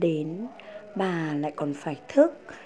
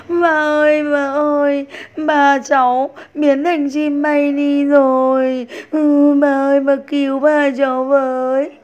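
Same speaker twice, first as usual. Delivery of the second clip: higher and louder tearful begging; crying plea; 6 semitones higher, 9 dB louder than usual. A narrator's reading. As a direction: cry out for help to the fairy. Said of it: "Tích Chu vừa khóc".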